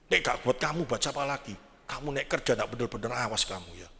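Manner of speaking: put-on low, hushed stern father warning the child; a father grumbling warnings at his son; stern father lecture. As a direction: lean in and lecture your kid quietly but firmly.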